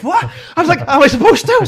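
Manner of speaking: loud, high-pitched alarmed questioning; retold panic. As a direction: act out panicking at the doctor's question. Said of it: "I'm fucking panicking now".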